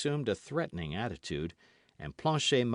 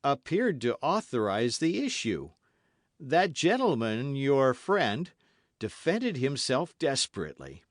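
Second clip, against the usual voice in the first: loud, higher tale; nervous innkeeper; 6 semitones higher, 6 dB louder than usual. A narrator's reading. Host recounting the fight, nervous. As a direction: tell it carefully.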